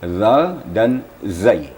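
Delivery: "zal dan zai" drawn out slowly; slow letter names. Shown as a demonstration. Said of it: "huruf zal ini ada kembarnya".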